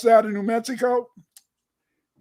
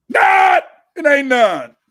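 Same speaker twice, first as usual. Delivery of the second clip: emphatic denial; 6 semitones higher, 9 dB louder, much slower than usual. Real, not acted.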